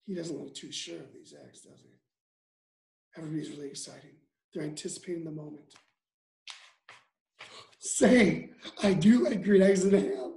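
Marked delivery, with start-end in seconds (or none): 0.1-5.7 much softer, lower commentary; soft hushed commentary; hushed aside. Real, not acted. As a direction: quietly narrate the suspense. Hushed commentary before tasting.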